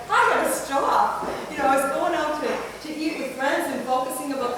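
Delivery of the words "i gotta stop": high, loud, laughing "I gotta stop"; laughing self-quote. Retold or imitated. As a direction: laugh it out as you catch yourself. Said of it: "And finally I'm like".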